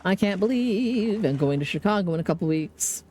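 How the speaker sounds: ordinary talk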